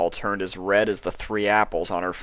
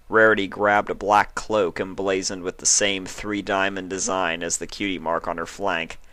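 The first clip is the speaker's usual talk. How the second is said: ordinary delivery, nothing marked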